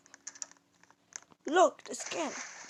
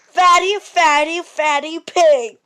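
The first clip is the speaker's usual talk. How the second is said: sing-song taunt; 3 semitones higher, 7 dB louder than usual. Pretend. Taunting the pig loudly.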